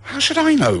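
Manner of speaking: high, loud panicked reply; frantic, high-pitched retort. A narrator's reading. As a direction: throw it back in a panic. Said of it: "replied Alice in a panic".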